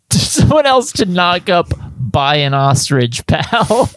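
slow, high, giggling through the taunt; laughing mutter. Acted. Taunting the buyer, a laughing mutter. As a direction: sneer it while laughing. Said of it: "I mutter under my breath".

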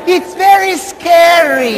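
high, drawn-out mock fright; hammed-up scaredy voice; mock scared. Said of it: "some people like that language".

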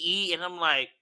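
ordinary delivery

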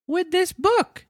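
put-on squeaky small boy answering the police; puppet boy. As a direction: Pinocchio answers the officers in a high boy voice. Pinocchio voice.

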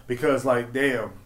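ordinary delivery

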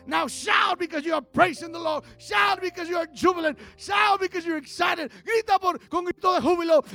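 high, loud victory cry; jubilant shout. Real, not acted. Urging the church to shout praise, jubilant. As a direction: shout it out in rising exultation. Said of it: "Grita como un vencedor".

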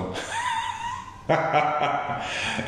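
long high-pitched laugh. Real, not acted. Speaker laughs at his anecdote about the interview, high laugh. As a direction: laugh in a high, drawn-out burst.

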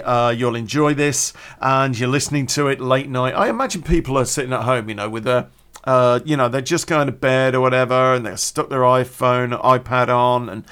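ordinary delivery

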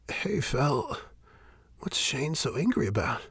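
lightly voiced Kili. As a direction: ask timidly. Kili's voice.

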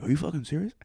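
high-pitched imitated amazement; mock disbelief. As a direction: play dad thrilled beyond belief. Imitates dad amazed by movie cover.

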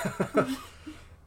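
high unvoiced giggle; airy burst of laughter; breathy laugh. Real, not acted. Laughing at Eomer joke.

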